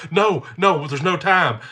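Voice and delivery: mock child's frantic protest; panicked six-year-old. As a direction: play a child insisting urgently. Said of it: "my six-year-old".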